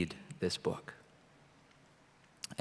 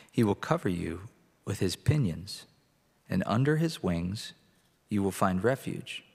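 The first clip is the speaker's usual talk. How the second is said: scripture reading. Acted. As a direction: read it out to the congregation. Reads Psalm 91 aloud.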